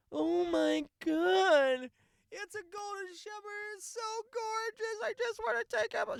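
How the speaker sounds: very high, slow, gushing squeal over a dog; mock gushing. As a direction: mimic a fake dog lover swooning. Mocking gushing over golden shepherd.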